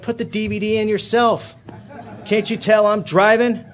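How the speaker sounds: dad who has had enough of being asked; irritated dad. Retold or imitated. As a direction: snap at the kid from the driver's seat, slowing down on the last line. Father snapping at son while driving.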